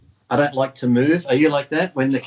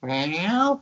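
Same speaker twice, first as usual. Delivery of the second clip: imitating cat meow; 5 semitones higher than usual. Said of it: "she'll reply something".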